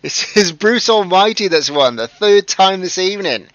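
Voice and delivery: high, loud, laughing through it; giddy with the silly moment; high and loud, cracking up; excited laughter. Real, not acted. Laughing host getting carried away.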